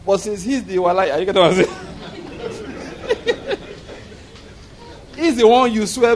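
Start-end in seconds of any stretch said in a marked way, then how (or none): 1.7-4.0 breathy high giggle; high airy laugh. Real, not acted. Preacher laughing at his own joke, high and airy. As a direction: laugh it off lightly.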